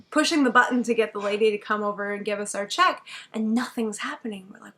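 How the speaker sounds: normal talking voice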